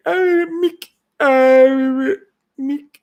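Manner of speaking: high pleading cry of Mick; Rocky calling Mick. Retold or imitated. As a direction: call the name up high and loud. Rocky quote again.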